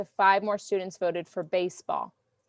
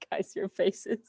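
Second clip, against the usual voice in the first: laughing; 4 dB quieter, slower than usual. Real, not acted. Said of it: "I'm laughing".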